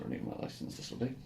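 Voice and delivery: ordinary talk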